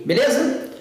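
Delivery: ordinary delivery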